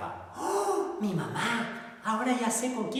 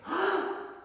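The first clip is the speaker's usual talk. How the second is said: startled gasp. Acted. Duckling startled.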